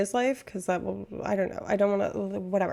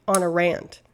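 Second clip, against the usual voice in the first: normal talking voice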